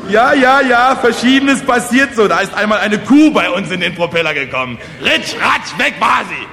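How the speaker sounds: high, loud, gleefully overexcited patter; carried-away village orator. Acted. Kosonossow boasts of accidents to the peasants, carried away. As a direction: reel off disasters with rising zest.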